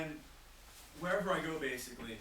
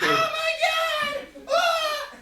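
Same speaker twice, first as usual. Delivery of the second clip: put-on much higher, loud shrieking girl, slow on "so hot"; squealing fangirl; 16 dB louder, much slower than usual. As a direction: scream it like a swooning fan. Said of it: "they'll be like".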